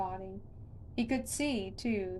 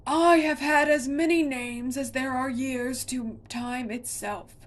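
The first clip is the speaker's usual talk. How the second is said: louder, higher, huge roaring voice; monster roar; 4 semitones higher, 9 dB louder than usual. A narrator's reading. Monster roars its names at Connor. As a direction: roar like an ancient giant. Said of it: "roared the monster".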